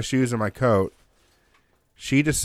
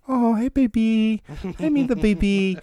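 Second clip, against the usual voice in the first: real nice; 11 semitones higher than usual. Retold or imitated. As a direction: coo to a runaway dog so she'll come close. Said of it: "So I start getting real nice".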